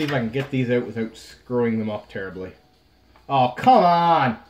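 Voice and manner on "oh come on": loud, drawn-out groan of real frustration; frustrated outburst. Real, not acted. Pack opener yelling at a ruined card, drawn-out angry cry. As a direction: yell it out, fed up with a damaged card.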